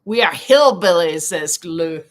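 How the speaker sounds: ordinary talk, nothing marked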